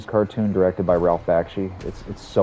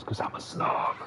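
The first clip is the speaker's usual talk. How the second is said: whisper; 10 dB quieter than usual. Real, not acted. Confessing snobbery as an aside.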